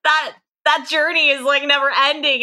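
lifted, wide-eyed wonder at a sudden idea; amazed realisation. Real, not acted. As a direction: caught up in the thought of a journey without end. Amazed realisation mid-conversation.

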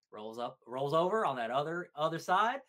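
usual speaking voice

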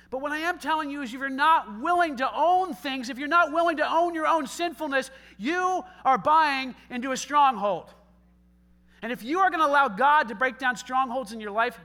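loud, emphatic preacher's build; full-force preaching. Acted. Pastor warning the congregation, loud preaching. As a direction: drive the warning home from the pulpit.

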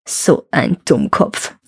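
slow, low gloating chuckle; gleeful gloating. A narrator's reading. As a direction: savour it with malicious glee. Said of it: "freute sich der Teufel und rieb sich schadenfroh".

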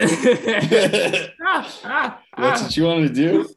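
high, loud question through laughter; real incredulous laughing; laughing disbelief. Real, not acted. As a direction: crack up while asking. Laughing at friend's escort idea.

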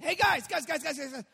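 loud, high, rapid-fire heckling; Elijah. Acted. Elijah interrupting the praying prophets, taunting. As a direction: pester them for attention, mockingly. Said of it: "Elijah began to taunt them".

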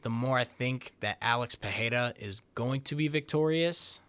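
ordinary talk, nothing marked

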